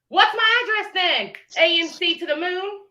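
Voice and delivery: mocking the rival's guesswork; mocking challenge. Real, not acted. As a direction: scoff at her, go up high.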